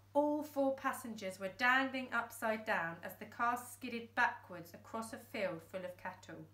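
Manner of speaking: ordinary delivery, nothing marked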